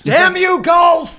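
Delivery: loud, high cry at the dice; mock outrage. Real, not acted. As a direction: shout your curse to the sky. Player cursing the bad roll.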